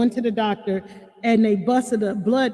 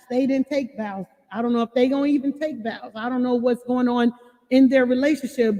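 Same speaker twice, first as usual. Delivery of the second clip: ordinary delivery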